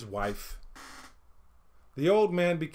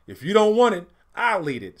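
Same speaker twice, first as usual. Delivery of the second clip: louder, higher grab-it boast; loud pushy oldest brother; greedy taunt; 6 semitones higher, 8 dB louder than usual. A narrator's reading. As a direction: taunt the brother for his food. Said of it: "said the oldest son".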